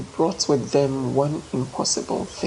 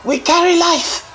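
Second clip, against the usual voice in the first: high, loud and unbending; iron-hard proclamation, much higher and louder; 8 dB louder than usual. A narrator's reading. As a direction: hurl it out as a hard defiant cry. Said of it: "voice like iron".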